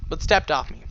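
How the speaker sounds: ordinary delivery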